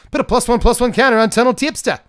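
high, loud mock New Zealand accent; hammed-up, badly faked New Zealand accent; terrible New Zealand accent. Card reviewer reading a card's rules for fun, bad New Zealand accent. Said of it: "New Zealand accent".

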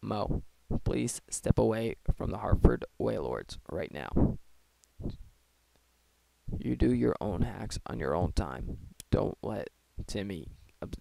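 low whisper right in your ear; close-mic whisper. Acted. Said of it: "I was standing up close to the mic and whispering".

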